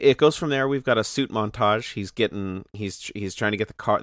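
ordinary delivery

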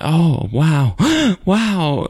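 high-pitched gasping wow; imitated gasping amazement. Rachel sees the stranger by lighter flash, imitated awed gasping. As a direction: gasp between the words in mock awe. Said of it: "like that".

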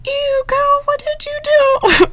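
high, drawn-out mock diva voice; sassy Bailey impression. Friend imitates Bailey teasing Addison, high and slow. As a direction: squeal it like a sassy surgeon.

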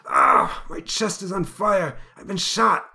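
high, slow pained cry; shot man's gasp. Acted. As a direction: gasp it as the bullet hits.

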